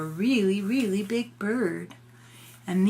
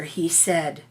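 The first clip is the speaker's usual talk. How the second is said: ordinary delivery, nothing marked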